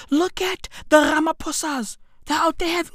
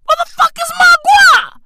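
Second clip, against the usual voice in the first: screamed rant, much higher and louder; mock furious shout; 8 dB louder than usual. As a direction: yell it like a robbed man. Comic rant opener, screaming.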